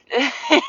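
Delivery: short high rising laugh; high giggle. Real, not acted. Amused by the dog's serious look, giggling.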